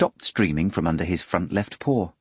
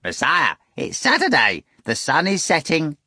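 rattling voice; 8 semitones higher than usual. A narrator's reading. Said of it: "A third rattling voice said".

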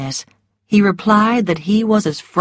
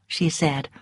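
ordinary delivery, nothing marked

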